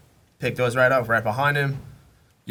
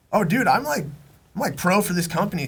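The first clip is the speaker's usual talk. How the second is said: much higher, eager boast; proud to announce he's pro; excited brag; 6 semitones higher than usual. Retold or imitated. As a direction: friend proudly telling about his sponsorship, excited. Friend brags about going pro.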